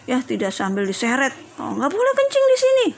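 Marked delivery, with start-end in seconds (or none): 1.6-2.9 high-pitched mock telling-off; acted rude reprimand; mock harsh scolding. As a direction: scold roughly, pitch shooting way up. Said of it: "itu namanya kasar".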